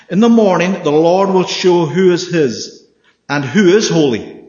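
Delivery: dramatic scripture reading with slow, weighty phrases. Acted. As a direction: proclaim the verses like a preacher. Reads the Moses scripture aloud.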